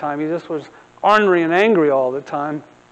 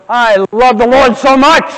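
loud, high burst of joy for the Lord; joyful outburst; 6 semitones higher, 8 dB louder than usual. Retold or imitated. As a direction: gush it out, beaming and loud. Answers joyfully in worship.

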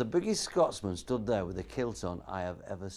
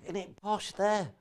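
mock posh; 8 semitones higher, slower than usual. Mocking posh Ilkley.

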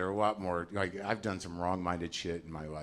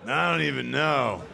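put-on embarrassed kid; 7 semitones higher, 4 dB louder, slower than usual. Kid with old dad answering.

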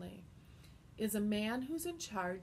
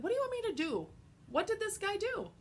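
much higher questioning voice; Pilate asking; 11 semitones higher, faster than usual. Acted. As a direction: ask it high and puzzled. Pilate asks the accusers.

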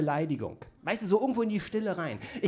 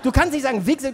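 ordinary talk, nothing marked

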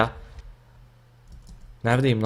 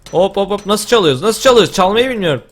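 panicked; 7 semitones higher, 5 dB louder than usual. Real, not acted. Panicking as base is stolen.

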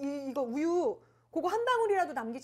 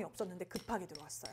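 whispered aside; hushed confiding whisper; 7 semitones lower, 11 dB quieter, faster than usual. Real, not acted.

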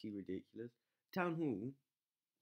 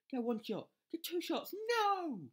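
high and loud, climbing to a cry; mounting alarm ending in a shout; rising panic; 7 dB louder, slower than usual. Real, not acted. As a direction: watch it slip away and yell. Watching the attack fail.

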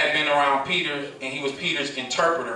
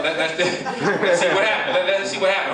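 amused, laughing through higher-pitched words; 5 semitones higher than usual. Real, not acted. Speaker to laughing audience before the whisper game, laughing. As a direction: stumble over the words with real laughter.

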